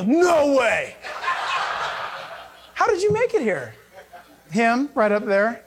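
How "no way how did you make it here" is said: high, laughing no way; acting amazed to see someone in heaven, laughing; laughing disbelief. Mock reunion in heaven.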